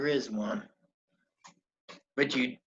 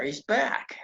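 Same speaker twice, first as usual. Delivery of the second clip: ordinary delivery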